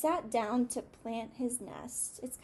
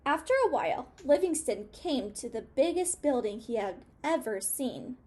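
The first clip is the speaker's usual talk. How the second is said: ordinary delivery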